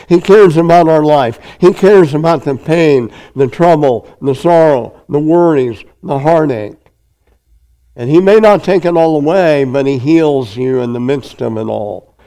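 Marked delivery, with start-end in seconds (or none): 0.1-9.6 impassioned preaching. Acted. Preaching climax on cares.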